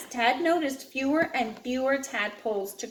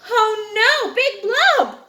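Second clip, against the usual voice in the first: loud, high frightened cry; alarmed cry; 10 semitones higher, 8 dB louder, slower than usual. Acted. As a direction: gasp it out as the monster appears. Reader's alarm at the monster.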